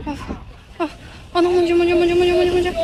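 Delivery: high yelps of pain, feet on hot sand; pained cries from burning feet. Real, not acted. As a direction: cry out as the ground scorches your feet. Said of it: "j'ai les pieds qui brûlent".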